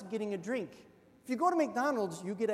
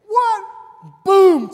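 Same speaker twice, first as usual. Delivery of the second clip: mock shout; 12 semitones higher, 21 dB louder than usual. Brother hits him, mock outrage.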